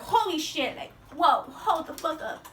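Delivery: slow, stretched out shocked whoa; yelping as she dodges the vomit; startled alarm. Retold or imitated. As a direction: recoil in disgust and surprise. Jumping away from the vomit.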